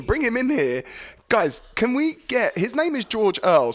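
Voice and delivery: calling out. Real, not acted. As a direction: call it out to the room. Calling out loudly.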